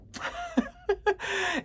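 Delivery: very high, squealing giggle; helpless high giggle at oneself; high-pitched laugh. Real, not acted. Cracks up at going off subject.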